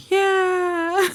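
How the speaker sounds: high, playful voiced reply; Norma's reply. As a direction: pipe up brightly as Norma. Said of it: "She's gonna be like".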